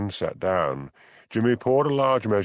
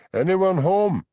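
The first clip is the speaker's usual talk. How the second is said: called out loud and high from the door; shouting; 10 semitones higher, 6 dB louder, slower than usual. A narrator's reading. Jimmy calling out as he lets himself into the kitchen, loud. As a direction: call out into the house. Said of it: "heard Jimmy Anderson's voice shouting".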